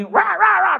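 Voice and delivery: sudden high, loud ra ra; mock barking. Pastor imitating his wife barking at them. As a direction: bark it out like scolding noise. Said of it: "nos ladraba todo el día".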